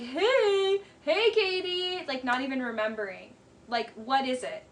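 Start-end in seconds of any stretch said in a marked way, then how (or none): 0.0-2.0 mock clueless drunk greeting. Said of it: "you're just like".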